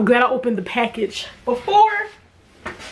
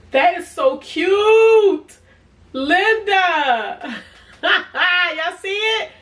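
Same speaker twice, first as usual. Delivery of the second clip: gushing delight; 8 semitones higher, 5 dB louder, much slower than usual. Real, not acted. Gushing over cousin's gift bow.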